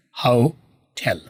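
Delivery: हाउटेल in two slow parts, 'how... tell'; very slow हाउटेल, falling on the end; English pronunciation. Shown as a demonstration. Teacher splits the word for students, English pronunciation. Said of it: "होटल करना है इसे".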